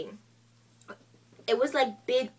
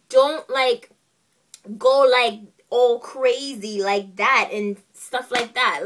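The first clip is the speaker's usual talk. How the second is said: louder, slower, telling her off; loud scolding; 7 dB louder, slower than usual. Retold or imitated. She scolds the beautician for tangling her hair, loud and slow. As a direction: lean in hard, scolding the washing.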